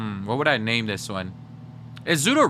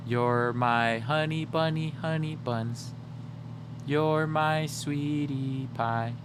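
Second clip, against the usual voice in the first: pet names; 5 dB quieter, much slower than usual. Real, not acted. Cooing to the pet.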